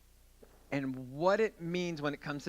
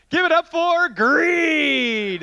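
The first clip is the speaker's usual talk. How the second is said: hype announcer; 11 semitones higher, 11 dB louder, much slower than usual. Acted. Announcing the volunteer as Greed.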